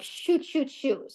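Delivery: high, quiet flustered muttering. Retold or imitated. Caught unready by early guests, flustered.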